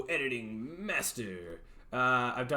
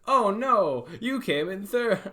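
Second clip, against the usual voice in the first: higher, louder gentle consolation; sympathetic; 5 semitones higher, 6 dB louder, slower than usual. Shown as a demonstration. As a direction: soften the blow for a kid. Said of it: "I need to be more like".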